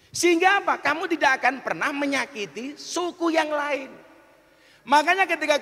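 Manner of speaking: loud, high-pitched sermon delivery; forceful preaching. Real, not acted. Preacher explaining lita'aruf to his audience, forceful preaching. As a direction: press each point on the crowd.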